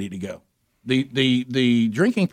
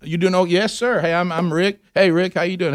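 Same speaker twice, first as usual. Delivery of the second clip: much higher, buddy-buddy schmoozing; mock chummy greeting; 7 semitones higher than usual. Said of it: "I said".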